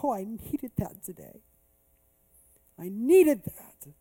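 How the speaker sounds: acted-out choked-up relief; retold tearful gratitude. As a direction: imitate a man moved to tears. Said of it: "he's crying from his eyes".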